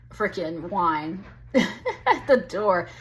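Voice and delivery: slow, breaking into a chuckle; amused laughing. Real, not acted. Reader joking about her cat at the door, laughing. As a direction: laugh through the words.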